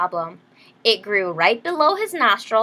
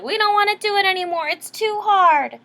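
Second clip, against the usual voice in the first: high, louder complaining scissors; mock whiny scissors, much higher; 10 semitones higher, 5 dB louder than usual. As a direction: squeal like fed-up scissors giving up. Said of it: "They're like".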